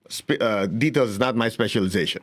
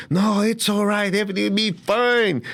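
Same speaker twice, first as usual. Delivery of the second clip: pat someone on the head with words; soothing comforter; 8 semitones higher than usual. Retold or imitated. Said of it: "somebody says".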